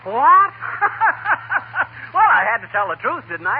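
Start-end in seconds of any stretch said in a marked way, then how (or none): 0.0-0.5 high, loud startled what; shocked. Real, not acted. Learns the poem was wrong. 0.6-2.1 high, embarrassed chuckle; sheepish laugh. Real, not acted. Laughs at his blunder.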